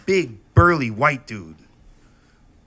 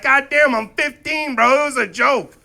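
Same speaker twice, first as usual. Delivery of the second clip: mock protest; 12 semitones higher, 7 dB louder than usual.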